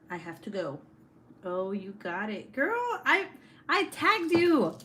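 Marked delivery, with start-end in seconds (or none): 2.5-4.9 high, loud and drawn out with delight; excited. Real, not acted. Streamer to friend on her live, high excited gush.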